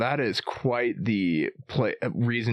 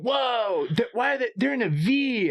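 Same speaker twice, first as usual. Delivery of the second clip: put-on high, wide-eyed six-year-old; excited kid voice; 10 semitones higher than usual. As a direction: play the amazed little boy. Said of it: "He goes".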